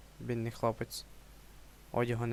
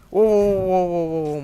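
переляканий вигук; 5 semitones higher, 11 dB louder than usual. Real, not acted. Злякався істоти.

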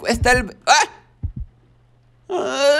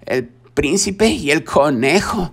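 storybook title read slowly and lower; 5 semitones lower than usual. Acted. Reading the book title aloud.

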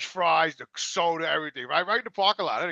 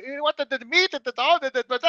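mock worker voice; 9 semitones higher, 7 dB louder than usual. Imitating drive-through worker.

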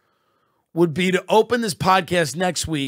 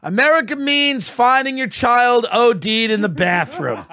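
higher, louder, laughing through a hammed-up patriotic address; mock rousing speech; 8 semitones higher, 4 dB louder than usual. Host hams up an ode to America, higher and louder. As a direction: swell it like a stump speech.

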